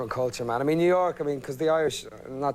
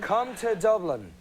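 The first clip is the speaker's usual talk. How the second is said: mock tourism ad; 6 semitones higher, slower than usual. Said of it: "Let's do an ad for Dublin together".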